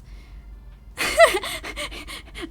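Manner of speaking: high-pitched burst of laughter; a real high giggle fit; high squealing laugh. Real, not acted. Laughs at accent question.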